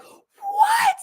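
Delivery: a stunned, high-pitched disbelief; shocked what. Retold or imitated. Host reliving the cliffhanger as a kid, a shocked high squeak. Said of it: "And I remember being like".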